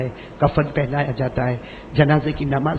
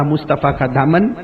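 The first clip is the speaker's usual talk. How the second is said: usual speaking voice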